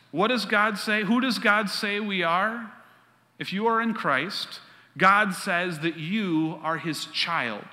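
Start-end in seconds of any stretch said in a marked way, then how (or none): none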